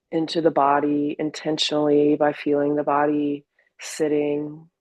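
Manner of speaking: calm, unhurried meditation guidance with long pauses; guided meditation. Acted. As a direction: ease them gently into the body. Leads a meditation.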